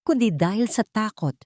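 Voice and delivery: plain reading voice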